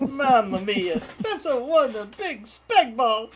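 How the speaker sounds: high, loud, slow hammed Italian accent; mock Italian accent. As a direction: play a cartoon Italian chef. Jokey Italian impression after spag balls.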